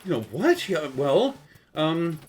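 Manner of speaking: high, loud mock-alarmed mother; overreacting mom. Mom telling her son he's dying, mock alarm. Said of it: "she's like".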